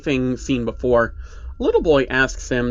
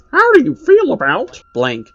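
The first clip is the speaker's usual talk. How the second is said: much higher, louder cartoon puppet voice; Pinky's puppet voice; 12 semitones higher, 7 dB louder than usual. Puppet host greeting the children, in a high, loud puppet voice. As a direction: chirp it out like a creepy kids' show puppet. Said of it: "Pinky asking questions like".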